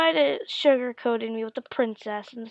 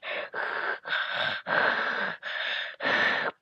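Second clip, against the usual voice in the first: Darth Vader breathing. Retold or imitated. Kid describes Darth Vader on screen, imitating his heavy breathing. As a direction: imitate heavy masked breathing in long pulls.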